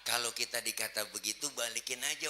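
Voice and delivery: ordinary talk, nothing marked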